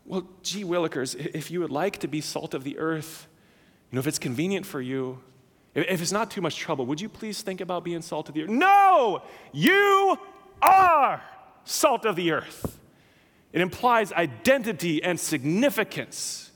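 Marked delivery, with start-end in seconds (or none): 0.1-8.5 soft, hesitant, fast and apologetic; mock a mealy-mouthed suggestion, quietly; timid mock request. Pastor mocking a weak version of Jesus, soft and timid. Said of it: "This is not a suggestion". 8.5-12.8 You are shouted high and loud; emphatic declaration. Shown as a demonstration. Pastor answering the timid version, emphatic shout. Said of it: "this is an emphatic statement".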